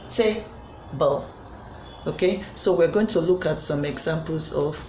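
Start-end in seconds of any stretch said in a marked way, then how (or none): 0.1-1.3 "Table" broken into ta... ble; syllable by syllable. Shown as a demonstration. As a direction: pause between the two syllables of "Table". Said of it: "It has two units of sound".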